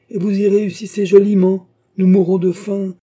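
loud, high-pitched scornful outburst; angry sarcasm. A narrator's reading. Beausire mocks Oliva's living from gambling, angrily sarcastic. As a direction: shout the reproach with scorn.

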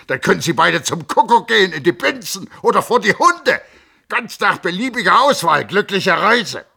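high, loud tirade, rushing in places; a raging doctor hurling insults; furious ranting. A narrator's reading. The Hofrat rages at the cousins before storming out, shouting. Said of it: "schrie er".

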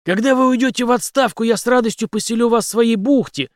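decisive, forceful reply; resolute. A narrator's reading. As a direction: declare it firmly, pitched high and loud. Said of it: "решительно ответил Генри".

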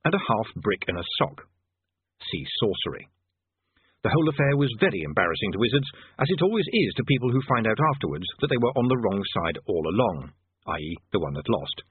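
usual speaking voice

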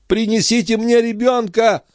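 very high-pitched desperate demand; crying out. A narrator's reading. Young mother demanding her baby, high cry. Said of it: "закричала молодая мать".

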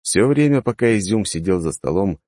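usual speaking voice

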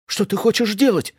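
high alarmed cry; shout. A narrator's reading. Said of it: "закричала Эдит".

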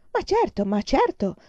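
put-on high, delighted child exclaiming; happy cry. A narrator's reading. Boy eagerly insists he can write, crying out happily. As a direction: burst out with joy. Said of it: "gridò lui felice".

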